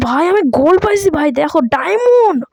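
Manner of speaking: real delight at a lucky find; thrilled discovery. Real, not acted. Gamer showing viewers his diamond, high thrilled excitement.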